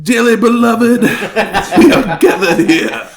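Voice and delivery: high, loud preacher voice with laughs; hammed-up minister opening a service; mock wedding officiant.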